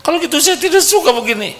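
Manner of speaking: very high, fast complaining wife; whiny wife. Acted. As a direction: rattle off a petulant complaint. Wife whines back. (istri merengek, cepat dan tinggi)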